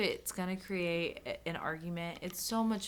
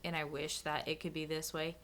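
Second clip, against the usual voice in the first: usual speaking voice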